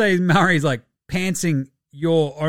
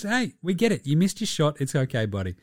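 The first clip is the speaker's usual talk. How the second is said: mock reassuring; 5 dB quieter than usual. Friend consoling Clay over a missed shot, mock reassuring. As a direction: gently talk him down. Voices someone soothing Clay.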